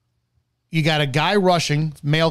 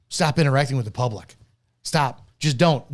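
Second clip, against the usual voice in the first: normal talking voice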